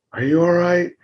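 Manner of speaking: stressing ALL in a worried call; anxious call. Acted. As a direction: reach out across the road to them. Acted crash scene, checking again.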